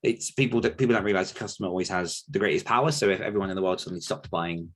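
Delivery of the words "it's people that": ordinary talk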